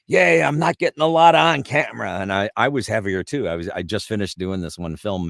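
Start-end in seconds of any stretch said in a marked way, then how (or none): 0.1-2.2 high, loud put-on voice; loud, high-pitched impersonation; Marty. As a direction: belt it out in a high character voice. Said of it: "Marty says".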